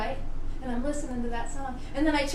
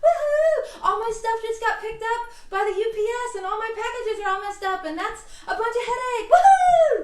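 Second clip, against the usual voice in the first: high shrieking cheers, laughing; giddy cheerleader; 10 semitones higher, 7 dB louder than usual. Pretend. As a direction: celebrate the disaster like a cheer squad.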